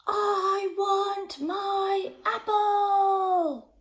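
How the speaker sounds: very slow, high, growled-out demand; angry goat. A narrator's reading. Goat Marvin raging at Molly over his apple. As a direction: stretch every word in a furious tantrum.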